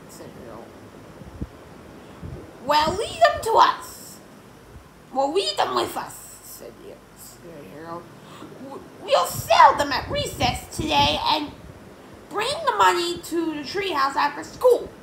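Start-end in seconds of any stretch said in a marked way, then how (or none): 2.6-6.1 high, loud character voice; Yesterday Harold insistent. Acted. Yesterday Harold taking over the comics, high and loud. 8.6-14.9 high, loud character voice; Yesterday Harold insistent. Acted. Yesterday Harold taking over the comics, high and loud.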